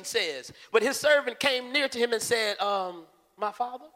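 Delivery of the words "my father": real polite. Acted. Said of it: "real polite".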